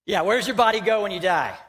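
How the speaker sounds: normal talking voice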